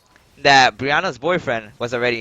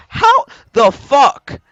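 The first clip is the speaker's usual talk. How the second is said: high, loud, slow stunned outcry; can't believe what he saw; loud drawn-out how the fuck; disbelief; 12 semitones higher, 9 dB louder, much slower than usual. Real, not acted. Disbelief at people diving for cash.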